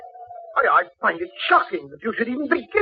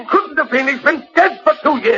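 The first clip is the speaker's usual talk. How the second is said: higher, tense retort; pitched-up nervous denial; rattled retort; 7 semitones higher than usual. Acted. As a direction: snap back in alarm. Stepfather argues, rattled.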